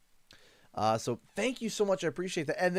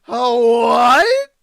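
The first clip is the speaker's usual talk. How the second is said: loud, high stammer of disbelief; real flustered cry; 6 semitones higher, 13 dB louder than usual. Real, not acted. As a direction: sputter in shock. Can't believe the donation.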